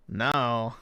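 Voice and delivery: ordinary delivery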